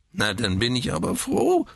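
put-on high, grizzling old man's voice; whining old man. A narrator's reading. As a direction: whine it out peevishly. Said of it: "greinte der Alte". (greinender Greis)

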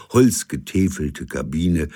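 usual speaking voice

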